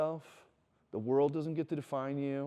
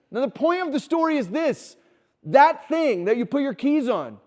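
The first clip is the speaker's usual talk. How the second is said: much higher, louder emphatic preaching; fired-up preaching; 9 semitones higher, 9 dB louder than usual. Real, not acted. Pastor driving home the lesson to the congregation, loud and fired up. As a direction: hammer the point home with energy.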